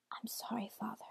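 very soft, hushed whisper; whispered apology. A narrator's reading. Son about to strike his father, hushed whisper. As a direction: whisper it with barely any volume. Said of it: "Hansel whispered".

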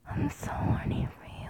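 ordinary talk

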